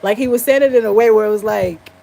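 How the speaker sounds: ordinary delivery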